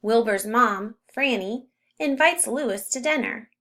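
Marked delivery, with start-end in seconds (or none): none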